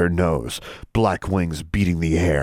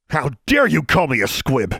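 louder, haughty indignant demand; outraged and arrogant; 9 semitones higher, 4 dB louder than usual. Acted. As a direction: play the proud outraged father. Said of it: "trying to imitate her father at his most outraged and arrogant".

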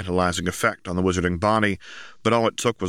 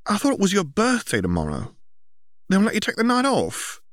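put-on high bright girl voice, much higher; young girl voice; 14 semitones higher than usual. A narrator's reading. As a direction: voice Ginny as a teenage girl. Ginny's voice in story.

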